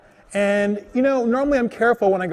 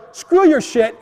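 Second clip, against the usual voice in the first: higher-pitched retold burst of anger; angry outburst; 5 semitones higher, 4 dB louder, slower than usual. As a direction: throw it out in irritation. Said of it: "I get more and more angry".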